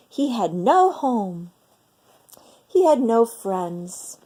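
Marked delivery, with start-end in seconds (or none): none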